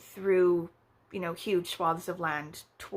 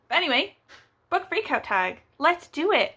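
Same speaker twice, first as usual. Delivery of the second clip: upbeat restart; 7 semitones higher, 5 dB louder than usual. Real, not acted. Rallying back to the tag.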